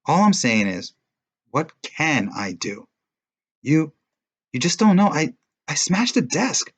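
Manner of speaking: upset defense. A narrator's reading. Boy defends himself, high and agitated. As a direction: defend yourself, rattled.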